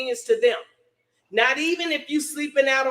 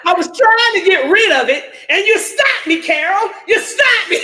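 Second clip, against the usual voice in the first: louder, higher complaint to mom; indignant yell; 6 semitones higher, 8 dB louder than usual. Retold or imitated. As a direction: accuse her, voice raised and pitched up. Retold yelling at mom Carol.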